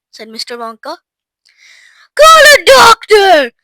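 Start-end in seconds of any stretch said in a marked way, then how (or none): 2.2-3.5 high, loud, drawn-out call for help; urgent shout. A narrator's reading. Father wanting a doctor for Violet, shouting. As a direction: holler for help. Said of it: "shouted Mister Beauregard".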